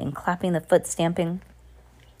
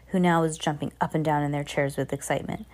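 ordinary delivery

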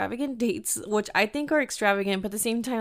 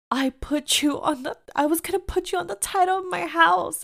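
high, louder imitation of an upset boyfriend; mock outburst; 9 semitones higher, 4 dB louder than usual. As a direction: blurt it out as his wounded protest. Retelling the man's outraged reveal.

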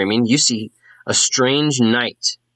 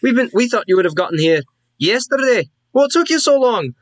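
high-pitched, scolding dwarf; indignant, high dwarven complaint; exasperated dwarf woman; 12 semitones higher, faster than usual. Acted. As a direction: scold the late arrivals in a high voice. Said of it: "she looks up and she says".

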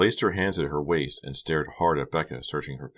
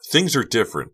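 loud, high, angry outburst; raised-voice teen protest; screamed protest; 7 semitones higher, 6 dB louder than usual. A narrator's reading. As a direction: burst out in frustration. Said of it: "Becca screamed".

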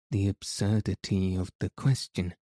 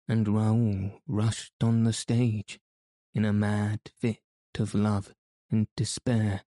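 plain reading voice

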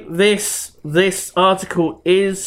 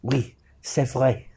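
French accent; 2 semitones lower, 6 dB quieter than usual. Shown as a demonstration. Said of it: "I'm translating for you".

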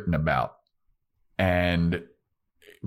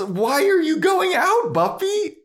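very high, louder incredulous cry; shrill disbelief; 4 dB louder than usual. Retold or imitated. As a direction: screech it at the heroine. Shrieking at Buffy's choice.

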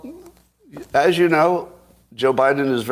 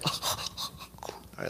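breathy near-whisper impression; a whispered, barely voiced all right; mock pundit sigh; Dean Obadiah impression; 16 dB quieter than usual. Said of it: "that's my impression".

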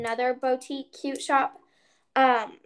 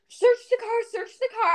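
high, urgent repeated command; frantic urging; 9 semitones higher, 4 dB louder than usual. Retold or imitated. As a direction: push everyone to search, pitched up and loud. Frantic order while searching car.

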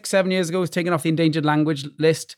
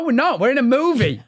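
higher, gleeful correction; playful excitement; 8 semitones higher than usual. Retold or imitated. Father corrects son that Brooklyn is a movie. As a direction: tease the son with wide-eyed wonder. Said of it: "And I go".

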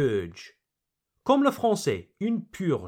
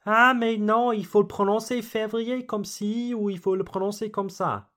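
higher, rapid French objection; fussy corrector; 6 semitones higher, much faster than usual. Acted. Teacher mimics someone correcting learners, rapid higher-pitched French fussing. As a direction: rattle off a know-it-all's correction in French. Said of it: "don't let anyone tell you".

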